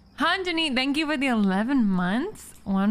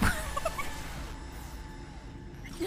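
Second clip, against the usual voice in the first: high breathless squeal of laughter; 12 dB quieter than usual. Real, not acted. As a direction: burst into a shrieking laugh. Big laugh after joke.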